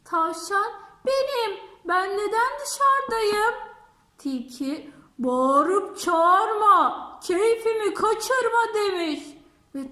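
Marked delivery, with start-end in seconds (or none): 1.1-3.5 pleading rabbit. Acted. 5.2-8.7 slow, loud, drawn-out scolding rhyme. Acted. Fox scolds the rabbit at the door, slow and loud.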